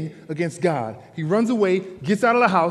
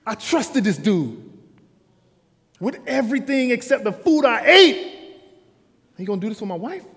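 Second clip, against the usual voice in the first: angry husband; 4 semitones higher, 5 dB louder than usual. Acted. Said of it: "Her husband is flaming".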